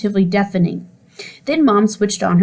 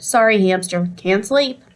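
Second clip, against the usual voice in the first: ordinary delivery, nothing marked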